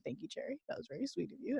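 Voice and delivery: soft tender thanks. Real, not acted. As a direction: thank her gently, nearly under the breath.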